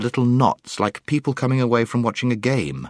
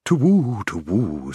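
owl hooting; slower than usual. A narrator's reading. Owl startled by news of Aslan, hooting. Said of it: "hooting kind of voice".

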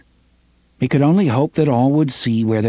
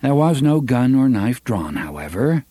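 ordinary delivery

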